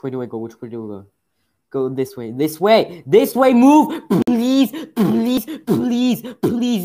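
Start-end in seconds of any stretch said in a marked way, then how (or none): 1.7-6.8 high shouted begging, building word by word; frantic pleading. Real, not acted. Player begs game characters to move, frantic high yelling. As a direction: beg at the top of your voice.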